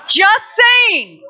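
high, loud teasing jab; playful shout. Real, not acted. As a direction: call it out cheekily, half laughing.

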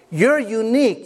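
ordinary delivery

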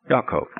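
"Yaakov" opening with a Y, no J; "Yaakov" said as in Hebrew, starting "Ya"; Hebrew pronunciation. Shown as a demonstration. Teacher repeating the Hebrew name for listeners, Y sound. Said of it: "there's no J".

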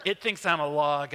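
ordinary talk

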